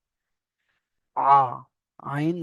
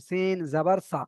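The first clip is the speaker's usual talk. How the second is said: সিন জাবার সা said short and crisp, with no stretching; quick, tight spelling, the right way; short clipped syllables; slower than usual. Shown as a demonstration. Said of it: "ছোট ছোট করে একদম".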